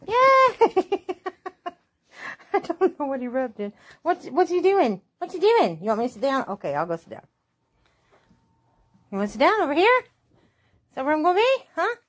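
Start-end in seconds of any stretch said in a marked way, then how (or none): 0.1-1.1 high, slow cheery dog voice; the owner putting words in the dog's mouth, squeaky and happy; voicing the dog. 4.0-12.0 high, loud pet voice. Real, not acted. Baby-talk to dog.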